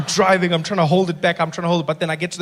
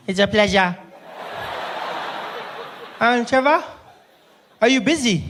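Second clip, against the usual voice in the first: put-on high little boy's voice on the phone; polite child, pitched up; nine-year-old brother; 4 semitones higher than usual. Little brother phones his big brother, high polite child voice. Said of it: "he's too polite".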